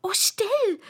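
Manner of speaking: high, alarmed hushing; very frightened. A narrator's reading. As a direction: hush her in high-pitched fright. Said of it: "flüsterte das Kaninchen in sehr erschrecktem Tone".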